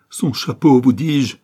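high, loud, distraught insistence; sobbing cry. A narrator's reading. Father broken over his son's hat, sobbing cry. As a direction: insist loudly through grief. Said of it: "sanglotait-il".

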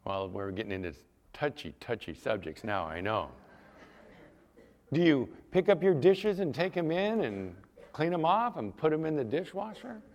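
ordinary talk, nothing marked

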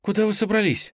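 startled, high-pitched question; surprised. A narrator's reading. Nastya to the teacher who is leaving, surprised. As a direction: ask in a high voice, taken aback. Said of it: "удивилась Настя".

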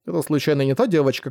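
ordinary delivery, nothing marked

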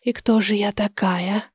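very soft, hushed question; whispered question. A narrator's reading. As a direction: ask it under the breath. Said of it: "прошептала я". (тихий вопрос шёпотом)

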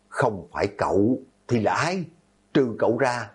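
usual speaking voice

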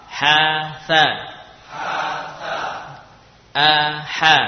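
slow, loud letter-by-letter reading; slow recitation. Shown as a demonstration. Teacher reading letters for students to repeat, slowly. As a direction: draw out each syllable, projected for repetition. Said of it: "pelan-pelan".

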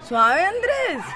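calling out. Acted. Friends breaking up a mock fight.

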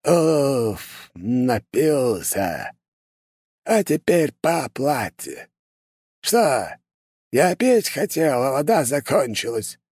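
put-on high, slow, creepy old sorcerer; eerie voice. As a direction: drag each word out like a ghoulish old man. Sinister old curse-maker voiced.

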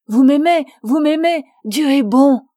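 loud, high cry of triumphant joy; joyful exclamation. A narrator's reading. Augustin to Fanny after her avowal, loud and elated. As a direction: burst out with the joy of being loved.

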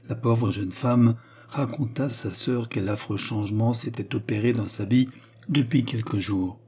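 ordinary delivery

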